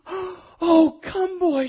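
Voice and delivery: high, excited stage whisper with voice in it; overjoyed gasping whisper. A narrator's reading. Tree too happy to speak greets boy, overjoyed whisper. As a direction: gasp it out, overcome with joy. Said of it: "she whispered".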